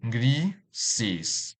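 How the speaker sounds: "grises" split in two, gri, ses; syllable by syllable. Shown as a demonstration. Teacher dividing a word for learners, "grises" in separate syllables. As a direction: say "grises" with a clear gap between its two syllables. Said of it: "This word has two syllables".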